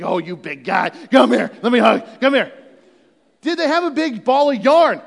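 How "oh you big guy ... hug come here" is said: louder, cooing voice to a big cat; pet talk. Pretend. Daniel cuddling a lion in the den, cooing. Playing with lions like pets.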